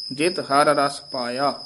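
normal talking voice

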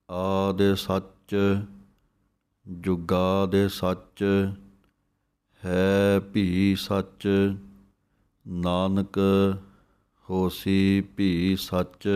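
drawn-out devotional recitation; slow recitation. Acted. Reciting the Mool Mantar to listeners, slowly. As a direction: let each word hang, slowly.

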